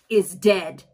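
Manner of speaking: usual speaking voice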